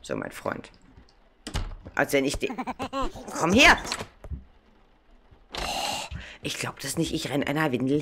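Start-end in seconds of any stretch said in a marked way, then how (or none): 3.4-3.8 sharp loud call, pitched up. Real, not acted. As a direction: yell after it to come back. Chasing a runaway diaper in game. (laut hinterherrufen)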